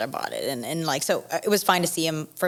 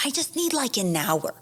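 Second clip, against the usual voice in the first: high-pitched, mock-distraught pleading; retold exasperated plea; 5 semitones higher than usual. Bride begging groom for an hour, high exasperated replay.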